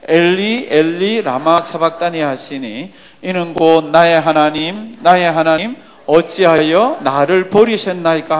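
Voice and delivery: loud, drawn-out scripture reading; strong, measured recitation of Jesus' cry. Acted. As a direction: read it out slowly at full voice for all to join. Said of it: "예수께서 크게 소리 질러 가라사대".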